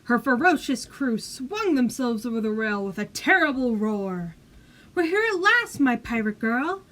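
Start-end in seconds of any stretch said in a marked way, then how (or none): 4.9-6.8 a much higher, louder hearty cry; joyful cry. Acted. Pirate mother greeting her daughter, joyful cry. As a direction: call out in triumph. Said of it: "cried Barbarous Bertha".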